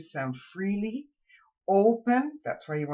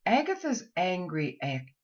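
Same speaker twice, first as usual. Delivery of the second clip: ordinary delivery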